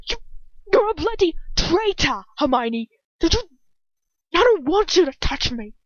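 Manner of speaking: high, loud, lashing accusation; full of hatred. A narrator's reading. Ron lashing out at Hermione as a traitor, hateful. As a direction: lash out with hatred, breaking off and restarting.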